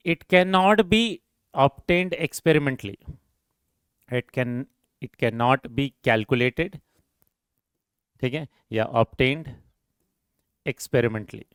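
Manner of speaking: drawn-out, phrase-by-phrase dictation; slow dictation. Acted. Teacher dictating a note to the class, slow and deliberate. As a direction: give students time to copy each phrase.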